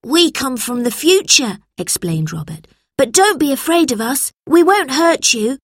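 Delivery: lightly voiced boy, calming; reassuring boy. A narrator's reading. Robert calms the frightened boys. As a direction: reassure them gently.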